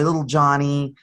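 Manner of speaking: ordinary delivery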